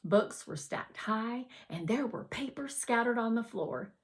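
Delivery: ordinary delivery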